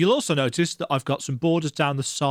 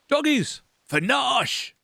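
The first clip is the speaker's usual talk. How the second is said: much higher, hammed-up battle cry; rally the guards, comically high; 11 semitones higher, slower than usual. Guard charging the enemy with a hammed-up high cry.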